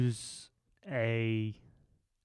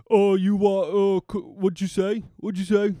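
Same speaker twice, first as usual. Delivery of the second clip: loud, slow, taunting street lad; mock the lad squaring up, loud; loud mocking impression of a lad picking a fight; 6 semitones higher, 7 dB louder than usual. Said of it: "Good impre".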